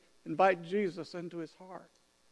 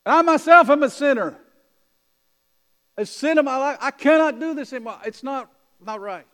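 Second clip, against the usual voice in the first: raised, higher voice of a distraught convert; anguished outcry; 6 semitones higher, 10 dB louder than usual. Retold or imitated. Alexei tells the preacher he can't preach anymore, anguished raised voice. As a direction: cry it out like a man who can't go on.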